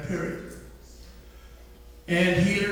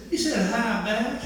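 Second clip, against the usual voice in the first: laughing; 5 semitones higher than usual. Retold or imitated. Retelling a child's funny answer.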